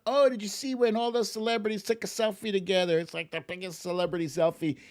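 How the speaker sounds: high, fast, excited office gossip; gushing coworker. Retold or imitated. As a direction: gush about the selfie like it's huge news. Coworker gushing about Oscar moment.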